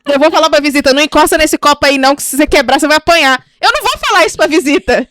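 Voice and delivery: loud, high mock scolding; comic outrage. Joking about scolding a house guest, in loud comic outrage. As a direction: belt out an absurd telling-off, incredulous.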